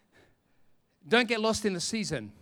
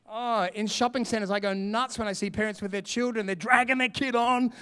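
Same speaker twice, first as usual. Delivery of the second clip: usual speaking voice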